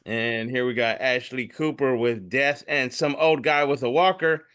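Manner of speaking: ordinary delivery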